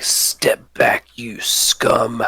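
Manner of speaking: hushed, slow hero's warning; hero's threat. Acted. Hero warning the mage off, hushed and slow. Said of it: "He's just like".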